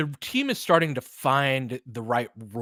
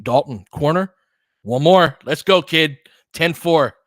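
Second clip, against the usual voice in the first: cheering; 3 semitones higher, 9 dB louder than usual. Real, not acted. Cheering Dalton's three for the viewers, loud fan cheer. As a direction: celebrate the shot like a fan.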